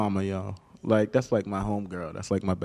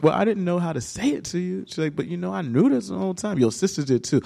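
a lightly voiced mom, pitched higher; mom's voice; 8 semitones higher than usual. Mother explaining to her son she knew, lightly voiced higher. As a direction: say it matter-of-fact, like mom.

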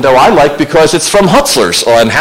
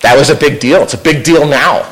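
ordinary talk, nothing marked

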